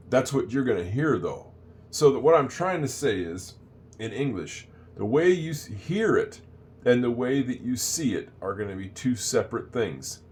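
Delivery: ordinary talk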